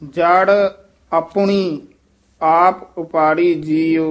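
ਆਪਣੀ and ਆਪ spaced apart, slow and clear; word-by-word demonstration. Shown as a demonstration. Teacher separates ਆਪਣੀ and ਆਪ for the student. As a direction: pause after each word. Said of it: "ਇੱਥੇ ਪੱਪੇ ਔਂਕੜ ਵੀ ਹੈ".

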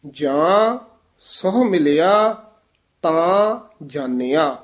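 pauses after ਜਾਂ and ਮਿਲਿਆ; ਜਾਂ, stop, lift ਸਹੁ ਮਿਲਿਆ, stop, ਤਾਂ ਜਾਣਿਆ; careful recitation. Shown as a demonstration. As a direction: teach it phrase by phrase, breaking after ਜਾਂ. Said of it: "ਇਹ ਵੀ ਪਾਠ ਧਿਆਨ ਨਾਲ ਉਚਾਰਨ ਕਰਨਾ ਹੈ".

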